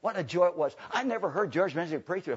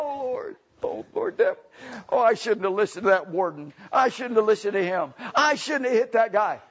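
mock-tearful regret, starting high and breathy; imitated weeping; 7 semitones higher, 5 dB louder than usual. Preacher acting the convert sorry he hit the man, in imitated crying. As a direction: wail the regret, growing louder. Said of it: "George starts weeping and crying again".

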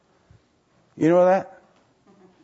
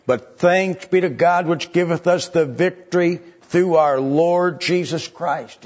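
preaching at full force; 4 semitones higher than usual. Acted. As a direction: lift the congregation toward the victory. First reading of the verse.

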